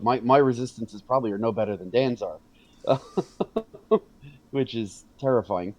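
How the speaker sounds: ordinary talk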